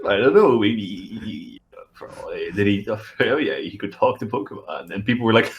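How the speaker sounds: usual speaking voice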